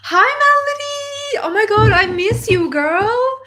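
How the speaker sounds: high, loud, gushing hello; excited greeting. Real, not acted. Spotting an old friend in chat, she gushes a loud hello. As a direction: greet her like a long-lost friend.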